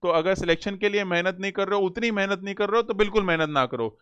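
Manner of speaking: normal talking voice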